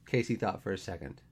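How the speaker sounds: usual speaking voice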